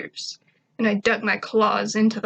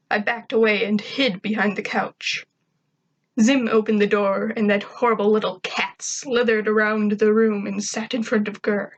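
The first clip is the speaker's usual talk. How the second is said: ordinary delivery, nothing marked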